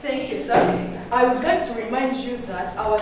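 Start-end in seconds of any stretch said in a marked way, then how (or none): none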